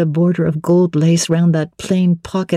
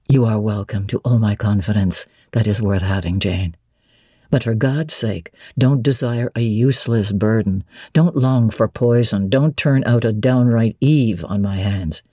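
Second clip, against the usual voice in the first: voiced lower as the brooding master; Mr. Rochester; 7 semitones lower than usual. A narrator's reading. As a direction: drop low and weighty, wary of her question.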